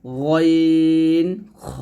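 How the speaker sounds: গইন খ held very long with a thick sound; drawn-out, full-mouthed গইন খ; thick, heavy articulation. Shown as a demonstration. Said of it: "গইন খটা মোটা করে উচ্চারণ করব".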